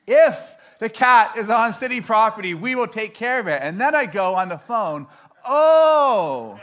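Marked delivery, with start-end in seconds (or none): 0.1-3.6 IF punched high and loud; loud, higher, insistent clerk spelling out a hint; emphatic repetition. Retold or imitated. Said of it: "She says it one more time". 5.4-6.6 loud, high, drawn-out "oh" sliding down; hammed-up penny-drop moment; dawning realisation. Acted sudden realisation on phone.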